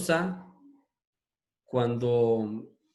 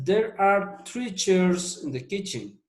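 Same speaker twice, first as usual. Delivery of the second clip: slow, higher English model reading; slow teacher demo; slow model sentence; 6 semitones higher, much slower than usual. Shown as a demonstration. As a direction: read it out very slowly for learners. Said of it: "La primera dice".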